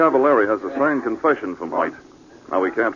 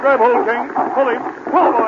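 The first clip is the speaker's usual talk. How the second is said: urgent shout; 10 semitones higher, 3 dB louder than usual. Acted. Fire rescue, urging the dog.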